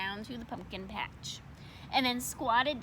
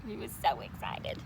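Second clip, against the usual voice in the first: whisper; 3 semitones lower, 4 dB quieter than usual. A narrator's reading. Storyteller aside.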